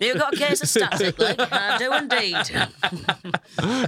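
a very slow, put-on character voice through a laugh; new character voice. Playing up static leg to her friends, a slow silly character voice. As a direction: drag it out in a silly invented voice. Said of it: "Good new character".

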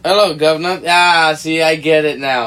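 put-on a loud, jokey British accent greeting; mock British accent. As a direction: play a loud London cabbie in a British accent. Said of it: "That was a bad accent".

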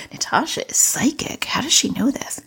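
half-whispered aside, playful; mock whisper. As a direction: whisper it as the amazed parent. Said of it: "You're like".

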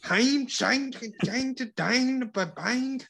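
high-pitched mock German rant; mock Hitler shouting. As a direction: imitate a cranked-up dictator ranting, very high. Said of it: "was just shouting for, like, hours".

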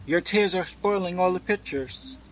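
put-on high-pitched girlish outcry; crying out. A narrator's reading. Girl scolds the weeping boy, crying out. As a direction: protest in a high voice. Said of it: "she cried".

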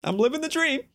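high, strained voice rising to a squeak; fake nonchalance. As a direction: act carefree while the pitch climbs absurdly. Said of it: "I said it like that".

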